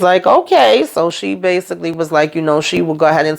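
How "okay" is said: a high, eager little okay. Retold or imitated. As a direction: grab the offer like he can't wait. Eager man agrees to meet.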